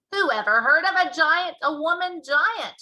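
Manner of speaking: loud, high giant shout. Acted. Giant waving the Snodscumber at Sophie, shouting. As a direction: bellow the question. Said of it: "shouted the B F G".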